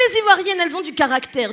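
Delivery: ordinary talk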